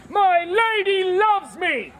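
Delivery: high, slow, drawn-out declaration; exclaiming. Acted. Manservant reading a love letter, high slow exclamation.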